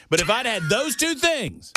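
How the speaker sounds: high, emphatic mock bravado; comic boasting. Real, not acted. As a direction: build the joke in a high, insistent voice. Comic boast about missed career.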